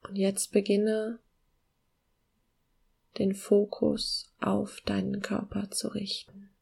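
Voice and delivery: slow, soft meditation voice with long pauses; calm guiding voice, gently slowing down; guided meditation. Acted.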